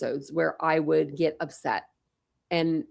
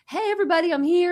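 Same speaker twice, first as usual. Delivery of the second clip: much higher sing-song greeting; cheery announcement; 12 semitones higher, 3 dB louder, faster than usual. Retold or imitated. New arrival greeting retirees, high bubbly call. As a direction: announce yourself to the whole room. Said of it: "I was just like".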